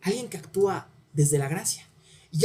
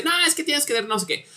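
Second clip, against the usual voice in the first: mock boss yell; 8 semitones higher than usual. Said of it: "y ese jefe le grita".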